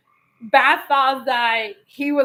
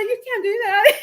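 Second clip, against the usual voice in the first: high mock protest; dismayed; 8 semitones higher, 4 dB louder than usual. Wife protests husband trashing book, mock dismay. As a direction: re-enact trying to stop him, pitch way up.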